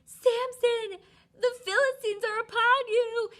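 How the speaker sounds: high, loud alarmed yell. Retold or imitated. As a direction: scream the warning across the house. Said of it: "she yelled".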